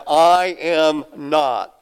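slow deliberate I am not, every word weighted; emphatic denial. Acted. John denies being Elijah, slow emphatic refusal. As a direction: plant each word of "I am not". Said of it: "emphatically denied".

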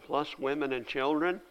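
ordinary talk

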